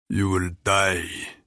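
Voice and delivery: lightly voiced Russian accent, slow and clipped; a hint of a Russian fighter's clipped threat. Fighter threatens the narrator in a clipped Russian accent. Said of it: "he clips in a heavy Russian accent".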